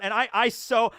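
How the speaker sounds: ordinary delivery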